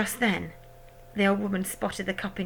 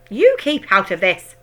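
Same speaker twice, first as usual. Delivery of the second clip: loud sharp cry; 13 dB louder than usual. A narrator's reading. Husband telling his wife to keep out, loudly. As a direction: snap it out loud. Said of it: "cried Mister Cranky".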